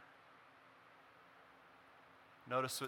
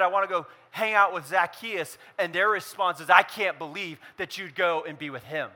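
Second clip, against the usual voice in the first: raised, driving sermon voice; impassioned preaching; 7 semitones higher, 10 dB louder than usual. Acted. Pastor urging the congregation, preaching at full force.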